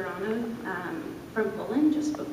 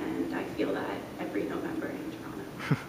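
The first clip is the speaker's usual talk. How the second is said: lower, breathy hushed finish; whispering aside; 13 semitones lower, 5 dB quieter than usual. Real, not acted. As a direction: drop to a whisper.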